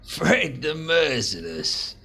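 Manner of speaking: slow, savouring the name; drawn-out mock-grand repeat of "Fred the Merciless". Boss savours the made-up name, slowly mocking. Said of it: "boss repeats the name mockingly".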